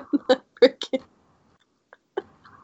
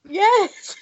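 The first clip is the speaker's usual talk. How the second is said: laughing; 12 semitones higher than usual. Real, not acted. Laughing while answering.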